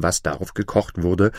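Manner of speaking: ordinary delivery, nothing marked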